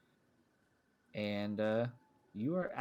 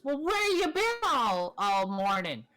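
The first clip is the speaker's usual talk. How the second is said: high, fussing little critter; fussy little mouse voice; 12 semitones higher, 4 dB louder than usual. Acted. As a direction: scold like a worried tiny mouse. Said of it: "Cinder goes".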